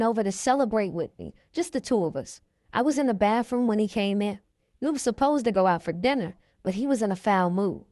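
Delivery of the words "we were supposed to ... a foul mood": usual speaking voice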